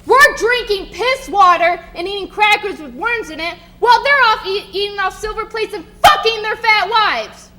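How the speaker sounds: loud, rising furious tirade; angry rant. Acted. Jenkins to Billy about the lords, furious rising rant. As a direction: build from bitter to roaring rage.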